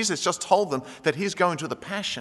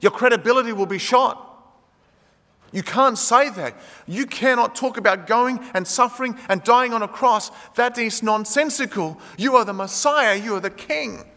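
raised, insistent protest; Peter rebuking Jesus; 5 semitones higher, 3 dB louder than usual. Retold or imitated. Peter pulling Jesus aside to scold him, insistent. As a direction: plead with him to stop talking nonsense. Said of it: "Peter takes him aside and says".

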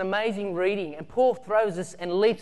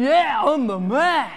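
pumped-up boast; 5 semitones higher, 8 dB louder, slower than usual. Retold or imitated. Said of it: "And we're going".

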